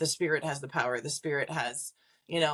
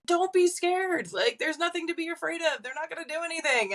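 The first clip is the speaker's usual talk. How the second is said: reassuring; 11 semitones higher than usual. Retold or imitated. Reassuring scared clients.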